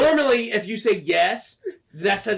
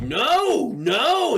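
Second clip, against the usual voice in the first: high, swooping denials; emphatic refusal; 7 semitones higher than usual. Retold or imitated. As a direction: refuse the caller with rising exasperation. Acted refusal to scammer.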